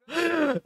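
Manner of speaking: high burst of laughter; short high laugh. Real, not acted. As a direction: crack up at the blunder. Laughs after goal.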